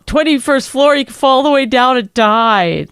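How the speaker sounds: high, loud mock panic about the drop; mocking panicked husband. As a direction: ham up his freaking out. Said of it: "And then you were like".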